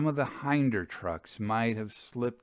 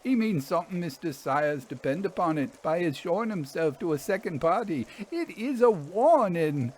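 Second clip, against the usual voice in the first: higher voice of an uneasy railwayman; haunted engine driver; 10 semitones higher than usual. Acted. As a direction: play the driver, set apart from the narrator, pitched up. Fern's warning.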